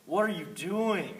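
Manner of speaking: high, loud and slow exasperated coach; imitating coach Plummer. Coach Plummer catching runners goofing off, loud and slow. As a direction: drag out the question like a fed-up coach. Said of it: "Plummer would just say".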